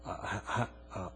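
very low, hushed stammering; hesitant doubting question. Retold or imitated. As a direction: falter quietly, as if unsure you dare ask. Doubting people mumble back to God.

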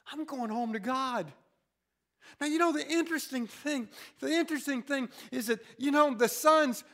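usual speaking voice

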